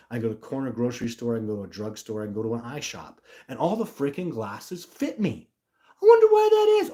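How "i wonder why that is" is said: very high, loud mock wondering; sarcastic. Real, not acted. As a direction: squeal it in fake puzzlement. Sarcastic question amid rant.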